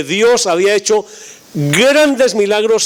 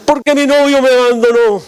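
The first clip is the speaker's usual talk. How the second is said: higher, louder mock wail of a little girl; mock crying girl; 6 semitones higher, 4 dB louder, faster than usual. Jilted ten-year-old wailing to the pastor, mock crying. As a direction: wail it like a heartbroken child, hammed-up. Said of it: "una niñita que estaba llorando".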